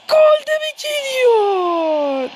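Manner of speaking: slow, high, stretched commentator call; drawn-out excited question. Pretend. Gamer drawing out the third-goal question like a commentator. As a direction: stretch it out for drama.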